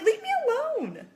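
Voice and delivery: high-pitched mock plea; acted squeal of exasperation; exasperated retort. Retold exasperated retort to judging moms.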